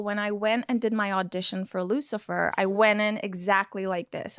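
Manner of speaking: conversational American accent; easy American accent, as at an audition. Shown as a demonstration. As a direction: switch into natural, everyday American. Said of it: "I just was conversationally American".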